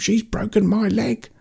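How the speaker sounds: very high creature wail; pained mock scream at moderate volume; screamed complaint. A narrator's reading. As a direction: cry out in hammed-up agony, high and sustained. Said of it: "the Gargan fan screamed".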